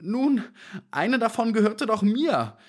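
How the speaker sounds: high-pitched, pitiful little man's plea; narrator's plaintive, high small-man voice; whimpering. A narrator's reading. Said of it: "wimmerte der kleine Mann".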